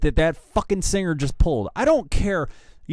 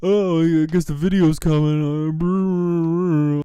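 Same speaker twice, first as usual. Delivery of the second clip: put-on dopey tweet voice, trailing into brr noises; mock singer voice; 2 semitones higher, 4 dB louder than usual. Host mimicking the singer posting on Twitter, mocking put-on voice. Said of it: "And then he goes on Twitter and just".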